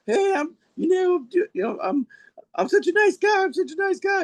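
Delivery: a high, put-on voice of a wrestler insisting he's a nice guy; cheesy heel wrestler. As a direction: play the fake nice guy wrestler, over the top. Voicing heel cowboy wrestler character.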